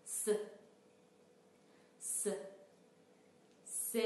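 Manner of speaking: a long voiceless hiss for س; hissed s sound. Shown as a demonstration. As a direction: hiss the س alone, softly, like a snake. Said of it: "لطفاً شما گوش کنید و تکرار کنید".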